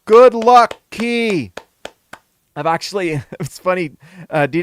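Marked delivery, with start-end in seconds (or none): 0.1-1.5 loud. Real, not acted. Cheering a viewer on.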